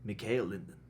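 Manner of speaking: put-on low, quiet Swedish accent. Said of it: "Do your best Swedish accent".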